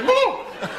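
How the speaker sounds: very high, loud startled whoop; mock horse shying in alarm; hammed-up high "woo" of fright; spooked horse whoop. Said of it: "And you go".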